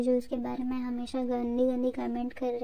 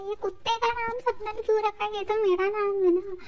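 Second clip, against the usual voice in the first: higher-pitched mock outrage; put-on indignant complaint, pitched up; indignant protest; 8 semitones higher than usual. Woman protests a dog sharing her name, mock indignant.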